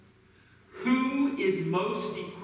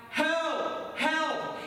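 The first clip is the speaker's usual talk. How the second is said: high-pitched storyteller's call for help; mock cry for help; 8 semitones higher than usual. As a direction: call up to a passerby, not full volume. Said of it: "Jimmy began to cry out".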